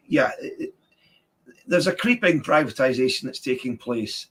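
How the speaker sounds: normal talking voice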